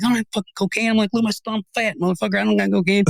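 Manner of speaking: high, exasperated denial; high indignant protest. Retold or imitated. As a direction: plead your innocence in disbelief.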